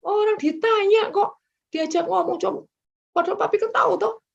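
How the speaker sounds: a high, fast, irritated retort in a put-on husband's voice; annoyed husband. The preacher imitates her husband, irked by her question, in a high, fast, irritated voice. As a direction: snap back high and fast, fed up with the question. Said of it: "tapi dia kesel gitu ya".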